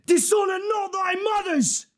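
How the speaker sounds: high, full-throated war-speech shout; battle rallying cry. Acted. Commander urging English soldiers into battle, rallying shout. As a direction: roar the troops on into battle.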